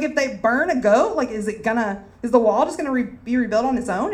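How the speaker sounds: high, taunting sarcasm; sarcastic reading. Retold or imitated. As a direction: scoff at the job, mockingly. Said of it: "sarcastic approach".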